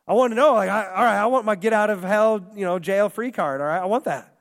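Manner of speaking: half-hearted mock of a greedy bad kid; eager plea. Pastor retelling his bad-kid self wanting salvation, eager and loud.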